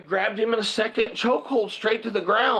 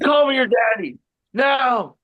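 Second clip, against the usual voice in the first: higher, forceful retold shout; shouted demand; 6 semitones higher, 5 dB louder than usual. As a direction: shout it like ordering a beaten bully. Retelling his shouted demand to bully.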